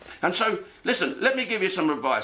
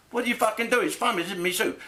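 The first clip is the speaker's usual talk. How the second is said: higher-pitched, exasperated outburst; mock indignation; 8 semitones higher, faster than usual. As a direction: blurt out a put-upon complaint. Acted outburst he held back.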